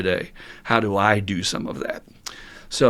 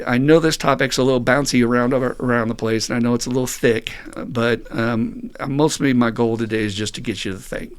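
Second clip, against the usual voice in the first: ordinary delivery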